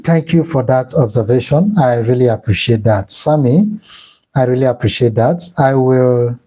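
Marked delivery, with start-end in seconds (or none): none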